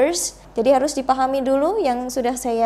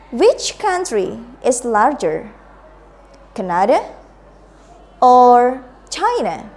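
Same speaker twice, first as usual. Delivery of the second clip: slow drill, "Canada" rising, "China" falling; choice-question intonation; 4 dB louder, slower than usual. Shown as a demonstration. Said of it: "let's repeat after me again".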